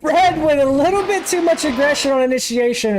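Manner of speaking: high, lifted live race call; excited play-by-play. Acted. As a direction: break down the hit quickly. Live call after crash.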